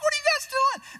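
high, sharp indignant challenge; the ten demand an answer, incredulous; indignant disbelief. Retold or imitated. Disciples confront the brothers, shrill indignant disbelief.

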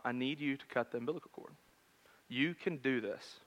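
calm a nervous dad, kindly; patient and gentle. Retold or imitated. Doctor coaxing the nervous new father, softly patient. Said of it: "and with great patience".

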